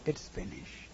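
soft breathy whisper. Acted. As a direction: whisper it to the trees. Grass tells the trees.

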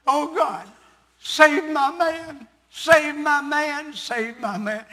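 fervent plea. Retold or imitated. Woman praying fervently, retold.

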